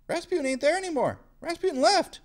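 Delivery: high, fast, alarmed squeal; shocked disbelief. Retold or imitated. Teacher voicing the shocked killers, high panicked disbelief. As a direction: voice jumps up in shock. Shocked discovery, Rasputin gone.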